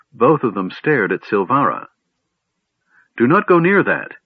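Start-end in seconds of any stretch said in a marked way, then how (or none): 3.2-4.2 high, loud, frightened warning; shaking warning. A narrator's reading. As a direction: warn them off, voice trembling. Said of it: "Silvara warned, her voice shaking".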